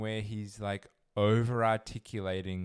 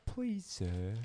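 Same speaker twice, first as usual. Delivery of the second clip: high, slow pleading orphan voice; Oliver Twist plea; 10 semitones higher, slower than usual. Pretend. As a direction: beg meekly like a workhouse boy.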